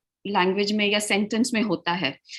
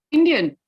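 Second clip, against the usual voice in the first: "Indian" lifted and louder; "Indian" asked loudly with raised pitch; question intonation; 3 semitones higher, 6 dB louder than usual. Shown as a demonstration. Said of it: "So I'm asking a question".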